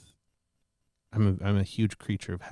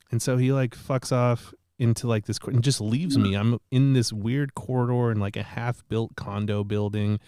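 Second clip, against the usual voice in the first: ordinary delivery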